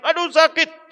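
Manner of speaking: high-pitched mock yelp; imitated cry of pain. Sinner in hell crying out in pain, imitated high cry. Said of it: "Ketika dia teriak".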